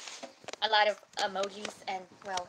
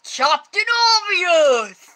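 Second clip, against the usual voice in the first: loud exclamation; 8 semitones higher, 15 dB louder than usual. Acted. Joking exclamation.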